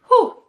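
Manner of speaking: high, loud exhaled whew of disbelief; overwhelmed whew. Real, not acted. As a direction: blow out a stunned breath. Reeling from Netflix plot twist.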